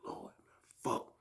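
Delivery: whispered curse of dread; dismayed whisper. Retold or imitated. Inmate hears his name called for testing, dismayed whisper. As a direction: breathe it out under your breath.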